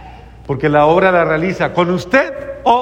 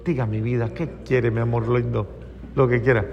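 a soft, lower, sweet reply to a husband; mock tender wife; 6 semitones lower, 7 dB quieter, faster than usual. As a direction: coo it softly like a loving wife.

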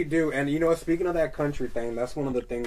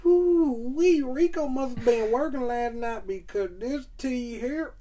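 high, drawn-out church-lady voice; older country lady; 14 semitones higher, 6 dB louder, much slower than usual. Retold or imitated. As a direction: sing it like an older Southern lady tasting sweet tea.

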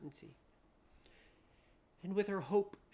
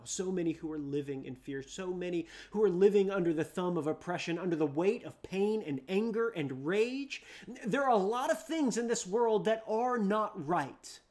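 louder, rising preacher's cry; fervent preaching; 2 semitones higher, 10 dB louder than usual. Acted. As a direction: drive the point home to the congregation. Preaching at full force.

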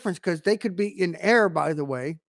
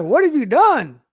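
loud, high-pitched demand; God confronting Adam; 6 semitones higher, 8 dB louder than usual. Acted. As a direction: thunder an accusing question as God. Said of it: "and God's like".

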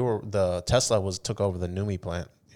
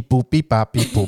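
loud robotic alien beeping, laughing; 3 semitones higher, 9 dB louder than usual. Pretend. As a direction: beep it out like a silly alien. Mocking the baby's odd name.

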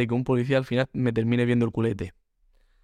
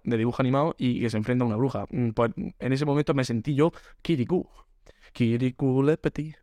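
usual speaking voice

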